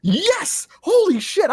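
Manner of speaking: high, loud burst of sudden recognition; real excited yelp; excited outburst. Real, not acted. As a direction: blurt it out as the memory hits. Sudden memory of the movie.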